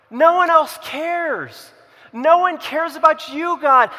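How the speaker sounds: loud, high cry of abandonment, acted out; anguished protest. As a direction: plead at full voice as the last one left, easing at the end. Elijah's despairing complaint to God, acted.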